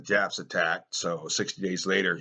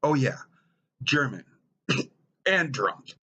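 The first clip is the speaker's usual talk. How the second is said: sudden realization; 6 semitones higher, 3 dB louder than usual. Acted. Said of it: "So what am I?".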